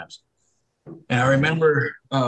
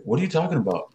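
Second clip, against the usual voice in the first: high-pitched imitation of a baffled denial; higher, incredulous comeback; protesting innocence; 7 semitones higher than usual. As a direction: act out a husband playing dumb. Accused father playing innocent.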